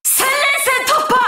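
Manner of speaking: high, exultant warrior call; triumphant announcement. Acted. Warrior announcing a thousand straight battles, exultant and high. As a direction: proclaim the milestone in rising triumph.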